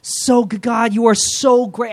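high, gushing cry of worship; acted rapture, stretched and effusive; overjoyed outpouring of praise. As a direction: pour out amazed thanks, pitched right up. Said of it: "the outpouring of praise".

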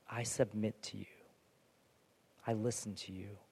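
whispered, hushed submission to God; hushed prayer. Pretend. Believer praying softly to God, hushed whisper. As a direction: breathe it out as a private prayer.

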